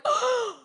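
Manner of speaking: sharp high gasp; shocked gasp. Retold or imitated. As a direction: gasp at icy water. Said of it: "I'm going like".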